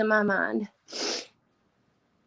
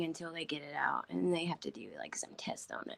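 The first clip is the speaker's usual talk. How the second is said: voice fading away; 4 semitones lower, 14 dB quieter than usual. Real, not acted. Voice fails while telling of mother.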